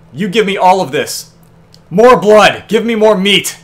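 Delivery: mock-monstrous loud craving; greedy demand. Greedily demanding game rewards.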